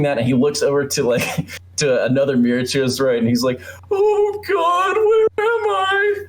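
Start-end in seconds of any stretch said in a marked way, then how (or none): 3.9-6.2 very high, drawn-out theatrical wail; mock-despairing howl, stretched slow and sky-high; Nicolas Cage impression. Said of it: "he talks in this weird accent throughout the movie".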